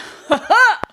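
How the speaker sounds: one high, loud "ha"; single surprised laugh. Retold or imitated. Imitating husband's reaction to his wife, single surprised laugh. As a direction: imitate a lone startled burst of laughter. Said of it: "Yep, just one, just one".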